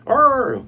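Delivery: short high, loud "arr"; mock battle cry from the crew; pirate roar. Imitating pirates' answering cry.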